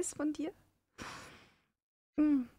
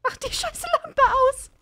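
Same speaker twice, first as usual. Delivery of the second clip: high frantic yelling; panicked; 14 semitones higher, 4 dB louder than usual. Real, not acted. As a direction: shout it in panic. Panic while hiding. (panisch geschrien)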